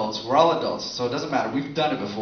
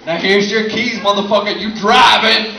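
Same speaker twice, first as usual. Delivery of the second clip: loud, laughing mock bro; mock dude shout; 5 semitones higher, 9 dB louder, slower than usual. Comic guys handing drunk friend keys, loud laughing mock bro. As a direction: bellow it through laughter.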